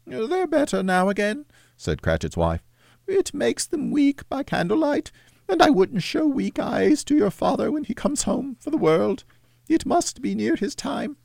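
hide the grief behind an excuse; sad mother. Acted. Mother's voice held.

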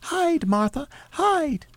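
put-on very high urgent child falsetto; young children crying out. A narrator's reading. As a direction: warn like little children in squeaky high voices. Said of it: "cried the two young Cratchits".

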